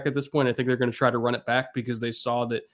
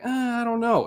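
doubtful skeptic; 11 semitones higher than usual. Retold or imitated. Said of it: "everybody's like".

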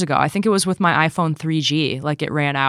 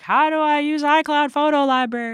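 whiny user; 11 semitones higher than usual. Retold or imitated. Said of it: "people are like".

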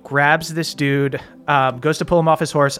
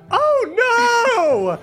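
high-pitched wail of dismay; panicked cry. Acted. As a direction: shriek it like a frightened boy. Stable boy cries out.

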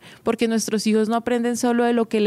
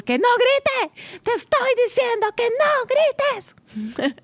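put-on much higher, shrill-edged scolding shout at the child; yelling mother; 14 semitones higher, slower than usual. Mother loses patience with her still-yelling child, hammed-up high yell. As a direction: lose your temper and yell. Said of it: "y otras".

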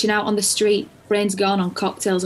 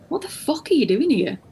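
surprised; 7 semitones higher than usual. Retold or imitated. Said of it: "And I was like".

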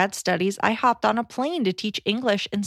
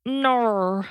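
drawn-out Australian "No"; attempted Australian accent; 3 semitones higher than usual. Shown as a demonstration. Asked to try the accent, drawn-out Australian attempt. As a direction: stretch the vowel into Australian "naur". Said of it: "Can you try an Australian accent?".

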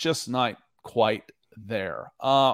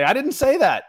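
indignant denial; 12 semitones higher, 6 dB louder than usual. Retold or imitated. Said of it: "sometimes I have to say".

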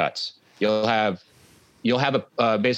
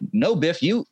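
higher, halting movie quote; coward standing up; 7 semitones higher than usual. Retold or imitated. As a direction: lightly imitate a coward finding his nerve. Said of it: "turning point of this complete coward".

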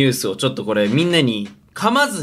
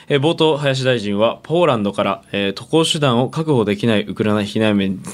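calm newscaster voice, lower; news anchor read; 5 semitones lower than usual. Acted. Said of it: "ニュースキャスターしようか".

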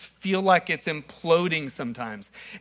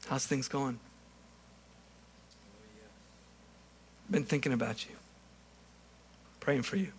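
soft, friendly host welcoming a guest; quietly glad to see an old friend; Jesus welcoming Shane to the table, softly; gentle Jesus; 4 semitones lower, 6 dB quieter than usual. Acted. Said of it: "And he says".

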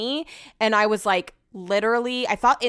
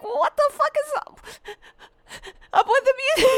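much higher, a cry in the voice, mock outraged; teary disbelief; faster than usual. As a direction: ask it high through tears, half laughing. Retelling reaction, still teary.

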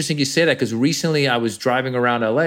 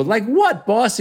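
put-on much higher, wide-eyed street kid; eager kid; 10 semitones higher, slower than usual. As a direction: ask the boss like a curious kid. Kids asking the boss.

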